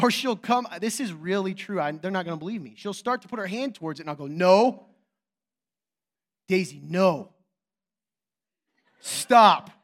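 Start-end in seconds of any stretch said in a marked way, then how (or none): 4.3-9.7 loud, forceful parental commands; firm scolding. Retold or imitated. Father as she reaches for the cord, loudly scolding. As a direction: stop a child with a sharp raised voice.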